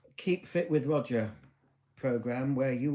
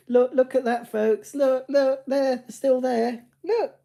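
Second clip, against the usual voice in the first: much higher and louder, eager look; excited; 13 semitones higher, 11 dB louder, slower than usual. Real, not acted. Vlogger pointing out carol singers to viewers, excited and loud. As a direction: call them to look, thrilled.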